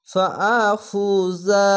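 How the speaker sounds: very slow recitation with the alif sounded; Quran recitation. Shown as a demonstration. Teacher reciting a word for students, very slow. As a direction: draw out ফাআফুজা, saying the আ. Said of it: "এখানে আলিফকে অবশ্যই পড়বেন".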